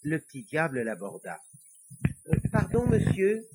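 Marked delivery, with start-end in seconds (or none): 2.3-3.5 high-pitched, polite little devil. Acted. Little devil trying to get the priest's attention, high and polite. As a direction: pipe up politely as a small devil.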